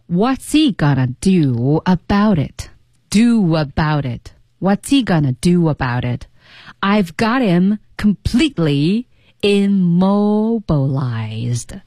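drawn-out, deliberate delivery; slow model reading. Shown as a demonstration. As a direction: stretch out every word of the boast. Slow demo of the villain's boast.

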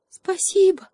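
high, loud little shout; monkey's shout. A narrator's reading. Monkey shouting thanks from above, high shout. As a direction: call it down from the tree. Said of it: "крикнула сверху обезьянка".

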